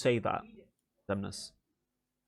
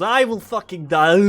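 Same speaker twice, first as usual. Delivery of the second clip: put-on Russian accent; 5 semitones higher, 9 dB louder than usual. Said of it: "just goes".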